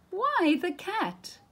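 high slow exclaimed reveal; surprised reveal. A narrator's reading. Narrator reveals the cat is back, surprised. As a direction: land it like a surprise.

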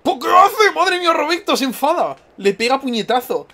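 high-pitched amazed squeal; excited, very high play-by-play reaction; astonished outburst. Real, not acted. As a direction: exclaim in shock, voice shooting up. Astonished as character gets angry in game.

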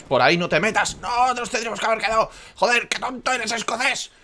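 high, loud exasperated scolding; angry yelling. Real, not acted. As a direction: tell him off loudly, fed up. Scolds Scottish ally in game.

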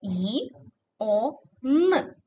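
"Ї, о, н" as three isolated sounds with gaps; separate sounds. Shown as a demonstration. Teacher transcribing sounds for pupils, each sounded separately. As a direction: sound out "Ї, о, н" one at a time. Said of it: "ми пишемо з вами тільки звуки".

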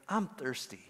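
small, soft imitation of a toddler; sweet little voice. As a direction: quietly imitate a sweet, sleepy-hour child's plea. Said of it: "in the sweetest little voice".

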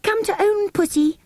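put-on very high, cooing baby talk; coaxing. A narrator's reading. Jane tries to win the baby over, coaxing. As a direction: coax a toddler in a high singsong. Second sister coaxes the baby.

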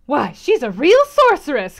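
loud, slow gleeful exclaim; thrilled at the fun; excited cry. A narrator's reading. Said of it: "cried Frederick Lynn".

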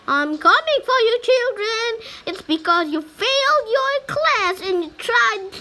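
high, loud mock-villain teacher taunt; menacing teacher. Player as teacher taunting the children, hammed-up menace. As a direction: gloat like a scary teacher chasing kids. Playing teacher hunting students.

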